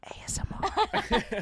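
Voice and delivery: slow, high whispered letters; ASMR whisper. Retold or imitated. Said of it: "She's gonna come up and go".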